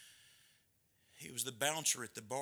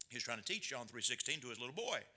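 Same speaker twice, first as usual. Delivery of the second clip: ordinary delivery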